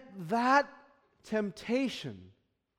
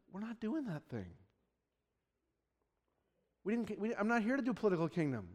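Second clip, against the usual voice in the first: calm correction; 7 dB quieter, faster than usual. Retold or imitated. Jesus answering disciples.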